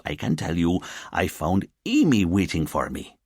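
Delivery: easygoing officer explaining himself to his boss; officer defending himself. Acted. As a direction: protest first, then talk your way out. Officer's character voice.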